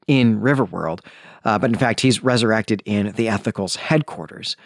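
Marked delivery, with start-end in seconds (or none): none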